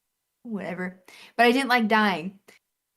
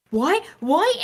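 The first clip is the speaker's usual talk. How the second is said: higher, rising disbelief; mock startled yelp, pitched up; retold startled alarm; 8 semitones higher, 4 dB louder than usual. Player seeing a big health bar appear, retold in rising alarm.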